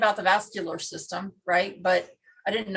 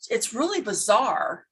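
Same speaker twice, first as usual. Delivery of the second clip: ordinary talk, nothing marked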